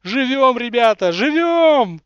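loud, high triumphant cry; joyful shout. Acted. Ликующий возглас в начале. (ликующий возглас)

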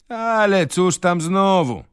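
wysoki, powolny głos starego urzędnika; przeciągnij to lekceważąco, wyżej i wolniej; urzędnik. Acted. Urzędnik speaks, reader voices him. (urzędnik zbywa sprawę, wysoko i powoli)

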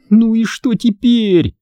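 high, drawn-out wail of a question; moaning. A narrator's reading. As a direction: groan it out slowly in a high voice. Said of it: "простонала Эмили". (протяжный высокий стон)

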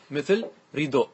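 ordinary talk

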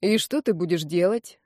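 usual speaking voice

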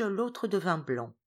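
ordinary delivery, nothing marked

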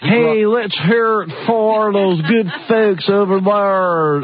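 slow, high mocking Southern twang; deep Southern drawl. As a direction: drag it out like a hammed-up Southern good ol' boy. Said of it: "deep Southern drawl".

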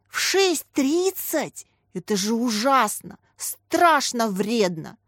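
mock horror. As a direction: stretch out the shocked words theatrically. Boy aghast at teacher's early rising.

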